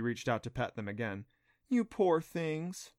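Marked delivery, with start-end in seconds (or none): 1.7-2.9 slow, higher-pitched coo; drawn-out sympathetic cooing. A narrator's reading. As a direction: coo slowly over small creatures. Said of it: "she cooed".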